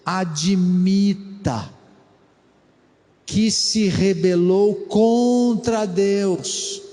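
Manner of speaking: very slow, contra Deus leaned on; stress on contra Deus. Shown as a demonstration. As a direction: drive home contra Deus. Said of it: "Esse contra Deus é importante".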